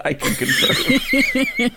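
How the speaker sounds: high, slow, laughing through the words; laughing confirm. Real, not acted. Co-host laughing as she backs up the roommate, amused. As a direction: giggle it out as you agree.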